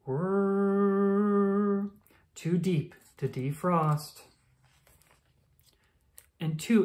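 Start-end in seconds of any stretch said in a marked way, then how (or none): 0.1-1.9 long, steady mock whirr; machine whirr. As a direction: hum like a defroster. Machine sound effect.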